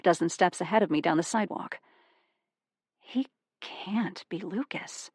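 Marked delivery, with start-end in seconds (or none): 3.0-5.1 slow, breathless whisper; whispered disbelief. A narrator's reading. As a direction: whisper it to yourself in shock. Disbelief at familiar stranger.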